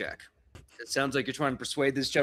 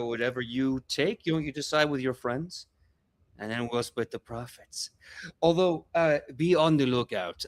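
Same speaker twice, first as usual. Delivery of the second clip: friendly Gomo act; 3 semitones higher than usual. Acted. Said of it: "that demeanor going back into, uh, your best friend Gomo".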